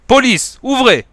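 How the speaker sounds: high, loud police shout; yelling. Acted. Policeman shouting at the door, very loud. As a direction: bellow it through the door. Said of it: "une voix a hurlé".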